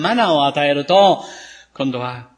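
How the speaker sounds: usual speaking voice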